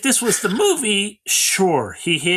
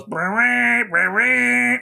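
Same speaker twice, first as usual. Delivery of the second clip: drawn-out higher monster cry; long raised imitation screech; imitated creature shriek; 5 semitones higher, much slower than usual. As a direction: mimic the waking queen's cry. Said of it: "the queen wakes up and goes".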